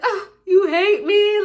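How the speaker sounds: loud, high, drawn-out wail; mock crying. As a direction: overact a tearful accusation. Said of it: "I'm literally crying".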